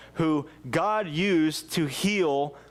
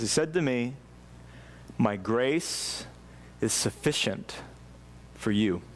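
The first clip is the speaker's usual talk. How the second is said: solemn scripture reading; 5 semitones lower, 3 dB quieter, slower than usual. A narrator's reading.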